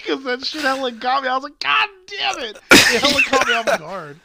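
very high, laughing squeal of surprise; laughing excitedly. Real, not acted. Explaining the joke to his co-host, high laughing squeal.

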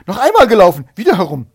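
high, very loud urgent shout. A narrator's reading. Hare demanding the race be rerun, urgent shout. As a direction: shout the order for another run. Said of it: "Er rief".

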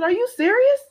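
as if the floor just dropped away; high startled are you serious; stunned question. Retold or imitated. Mother reacting to shocking news.

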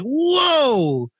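high, drawn-out mock whoa; retold astonishment. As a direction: re-enact being floored, pitch swooping up. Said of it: "I'm like".

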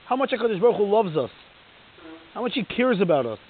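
ordinary delivery

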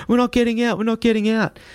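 high, repeated strained no; panicked refusal. Retold or imitated. Passengers refusing the driver again, high panicked. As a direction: hold the line, scared.

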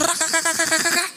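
hammed-up fake laughter; mock laugh. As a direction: laugh it out like a mocking cartoon. Mocking laugh mid sermon.

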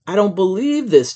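loud, high cry of disbelief; excited holler. A narrator's reading. Boy seeing the new bunk beds, hollering. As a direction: holler it like an amazed kid. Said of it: "Jeremiah hollered".

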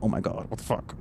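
whispered mock dread; hushed dread. Reacting to a worrying phone call, hushed dread. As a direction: drop to a whisper, as if dreading bad news.

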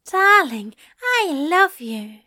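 high, ardent lover's cry; Aziraphale, adoring. A narrator's reading. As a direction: pour the love out, rising to loud. Breathless kisses.

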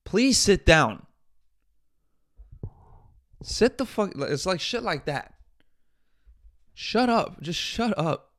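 loud fed-up shut up; exasperated shout. Real, not acted. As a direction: tell her off with real exasperation. Ranting at celebrity, rising anger.

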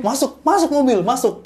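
very high, loud shouted order; frantic shout. Retold or imitated. Woman screaming at her friends to get into the car, frantic shout. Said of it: "gitu kan".

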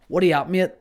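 a hint of a mate calling hello; casual greeting. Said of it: "where I'm saying".

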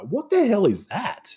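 high, drawn-out disbelief; slow, high-pitched exasperated outburst; baffled outrage at a silly plot; incredulous. Real, not acted. Mocking a silly plot point.

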